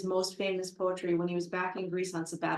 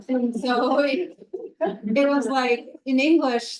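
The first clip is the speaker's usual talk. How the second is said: laughing; 4 semitones higher, 8 dB louder, slower than usual. Real, not acted. Laughing at the malaka punchline.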